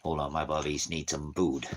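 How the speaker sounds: ordinary delivery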